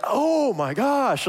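high, drawn-out groan of frustration; hammed-up exasperated outburst; mock exasperation. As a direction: stretch it out as if driven up the wall. Acted exasperation in failed argument.

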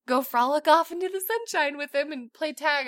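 ordinary talk, nothing marked

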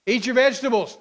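parent command. Retold or imitated. Parent ordering child.

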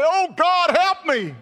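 loud, high plea to God; acted cry for help; desperate cry out. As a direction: cry out for rescue at full voice. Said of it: "and you cry out".

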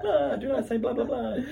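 high, laughing mock vampire. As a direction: ham up a Dracula line.

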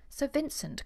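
lightly acted exclamation; crying out. A narrator's reading. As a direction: cry out to him in mild alarm. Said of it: "cried Sophie".

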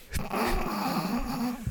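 high laugh; amused burst of laughter; real laughter. Real, not acted. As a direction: break into a high-pitched laugh. Laughs after dropping the tangent.

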